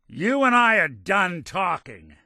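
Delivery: high, loud, slow and final; angry dismissal. Acted. Character angrily cuts off partner.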